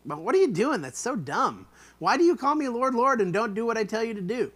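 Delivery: fed-up rebuke to the crowd; exasperated Jesus. Shown as a demonstration. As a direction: throw up your hands at them. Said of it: "attitude does he have here?".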